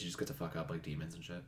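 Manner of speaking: normal talking voice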